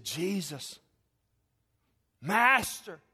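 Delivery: plea called out, rising on Master; imitated crying out. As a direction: call out to someone down the road. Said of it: "They cried out".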